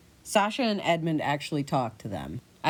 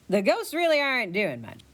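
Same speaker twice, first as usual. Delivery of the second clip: ordinary talk, nothing marked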